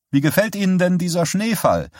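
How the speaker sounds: overly cheerful, pitched high; a bit too hearty. Acted. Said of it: "vielleicht ein bisschen zu herzlich". (etwas zu herzlich, deutlich höher)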